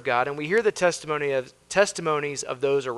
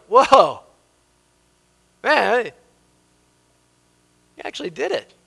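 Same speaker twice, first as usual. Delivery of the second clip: high-pitched amazed act, starting loud; mock surprise; 7 semitones higher, 7 dB louder than usual. Believers seeing God keep his promise, acted surprise. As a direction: imitate amazement, dropping off in volume. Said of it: "How many times are we surprised".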